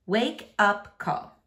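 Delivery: ordinary delivery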